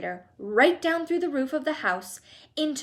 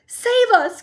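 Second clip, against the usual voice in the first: much higher and louder, shrieking; old Grandma Josephine crying out in terror; terrified cry; 12 semitones higher, 11 dB louder than usual. A narrator's reading. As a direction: scream for rescue. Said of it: "cried Grandma Josephine".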